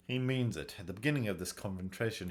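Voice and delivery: ordinary delivery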